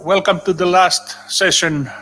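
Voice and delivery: normal talking voice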